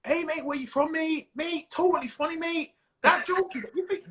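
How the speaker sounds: high-pitched, keen Manchester punter; Manchester accent. Acted. Manchester fan greeting comedian after show, Mancunian accent. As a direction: put on an effusive Mancunian voice, pitched well up. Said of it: "it's totally different".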